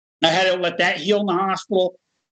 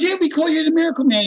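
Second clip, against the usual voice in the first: put-on excited kid recognizing him; 11 semitones higher than usual. Kid in the ER recognizing the miracle man, high excited voice. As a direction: imitate an awed kid, pitched way up. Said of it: "A kid sitting there, he's going".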